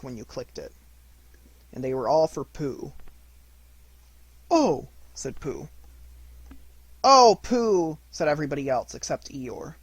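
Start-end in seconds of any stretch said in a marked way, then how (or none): 4.5-4.9 high, loud surprised oh; delighted gasp. A narrator's reading. Pooh seeing his pencil case, a high delighted gasp. 7.0-8.0 high, loud admiring cry; admiring chorus. A narrator's reading. Everyone admiring Pooh's present, high and loud.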